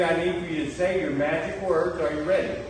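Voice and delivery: normal talking voice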